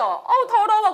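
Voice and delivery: high, grossed-out groan; disgusted reaction. Acted. Pastor acting out tossing a dirty tissue, disgusted. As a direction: act out mild revulsion.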